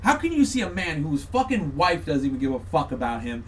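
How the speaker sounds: ordinary delivery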